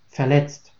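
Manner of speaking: plain reading voice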